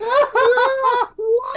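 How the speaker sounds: very high, loud wail of fan joy; excited shriek. Retold or imitated. Fan reliving Panzer Dragoon music starting, shrieking with excitement. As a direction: let out a piercing mock freak-out squeal. Acts out losing his mind.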